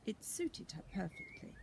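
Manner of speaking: ordinary delivery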